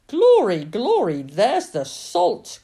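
loud, jubilant exclamation; exultant, ringing shout; triumphant cry. A narrator's reading. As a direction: cry out in triumph, drawing out the end. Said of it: "cried his mother triumphantly".

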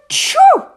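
explosive, high, loud sneeze; huge comic choo; acted sneeze. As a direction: let the sneeze burst out. The sneeze bursts out.

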